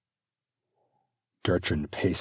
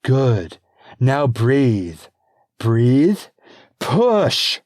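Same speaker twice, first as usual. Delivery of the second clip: higher, loud, firm urging; urgent birthing coach; coaching midwife; 8 semitones higher, 12 dB louder, much slower than usual. Acted. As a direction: coach her through the pain. Midwife urges pushing.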